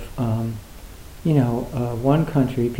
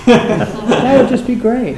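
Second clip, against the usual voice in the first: laughing it out, much higher and louder; 8 semitones higher, 7 dB louder, slower than usual. Real, not acted. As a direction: say it through a delighted laugh. Imagining a peaceful world, laughing.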